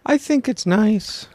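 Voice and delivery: sweet earnest. Real, not acted. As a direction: say it like a tender little angel. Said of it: "quivering, little angel you just became".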